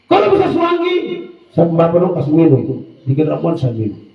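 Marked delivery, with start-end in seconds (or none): none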